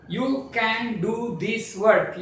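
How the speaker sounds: slow, separated words for counting. Shown as a demonstration. Teacher counting the words of a sentence, word by word. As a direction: space out YOU CAN DO THIS WORK. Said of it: "Five words".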